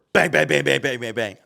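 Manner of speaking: rapid-fire loud bangs, falling off; loud, high burst, tapering away; imitated door pounding.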